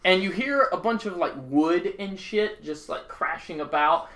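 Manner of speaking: ordinary talk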